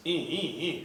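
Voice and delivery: short repeated engine-cranking squeals; imitated motor cranking. Boater turns the key on a dead motor, imitated cranking. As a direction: voice a starter motor straining. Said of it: "I go to start the motor".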